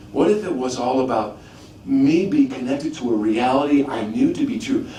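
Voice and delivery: normal talking voice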